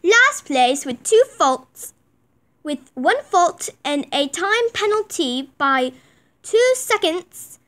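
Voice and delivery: slow, loud show announcer. Pretend. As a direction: call out the placings like a ringmaster. Announcing the placings.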